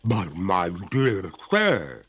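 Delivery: drawn-out, reluctant ghost lady; pale reluctance. A narrator's reading. Ghost protests to the master, slow and reluctant. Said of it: "with a pale reluctance".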